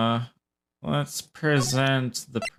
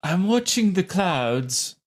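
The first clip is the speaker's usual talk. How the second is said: put-on slow, slightly higher go at a French accent; attempted French accent; 7 semitones higher, slower than usual. Reading the game's cloud-watcher aloud, attempted French accent. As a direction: have a weak stab at a French accent. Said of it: "I'm not good at this accent".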